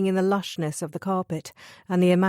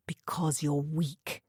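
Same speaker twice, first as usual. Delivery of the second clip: spat; 3 semitones lower, 6 dB quieter, slower than usual. A narrator's reading. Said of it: "spat Cameron".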